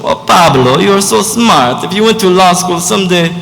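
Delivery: put-on proud mama with a Spanish accent, lilting up high. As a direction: play a doting mom from Spain, Spanish accent. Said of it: "I tweak my accent a little bit".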